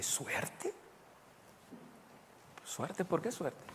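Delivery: hushed, puzzled questioning; soft, disbelieving echo of a word; puzzled reply. Retold or imitated. Pastor retelling his reply to an acquaintance, softly puzzled.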